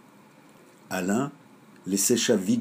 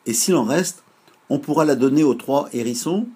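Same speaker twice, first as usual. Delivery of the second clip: put-on higher, louder childlike asking; eager question; 7 semitones higher, 7 dB louder than usual. Shown as a demonstration. Lizou Bizou asks grandpa about the three hedgehogs, higher and louder, eagerly. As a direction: ask with bright hope.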